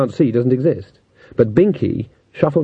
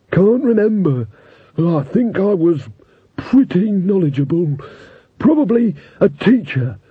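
a forgetful skull musing aloud; skeleton voice; 6 semitones higher than usual. A narrator's reading. Talking skull character.

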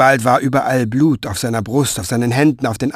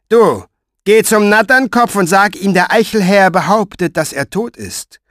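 high, loud curt order; commanding Pfeifer; 8 semitones higher, 6 dB louder than usual. A narrator's reading. Pfeifer ordering a soldier to the Natternkopf, high and curt. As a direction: send him off with contempt.

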